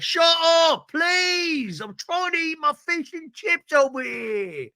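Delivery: high-pitched hammed-up complaint, loud at first; mock annoyed local. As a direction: play an exasperated diner telling tourists off. Voices annoyed London diner.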